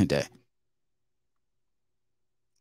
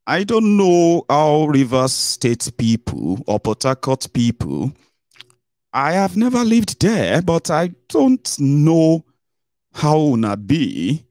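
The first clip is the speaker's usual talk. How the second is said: slow musing; 6 semitones higher, much slower than usual. Real, not acted. Musing about Port Harcourt people.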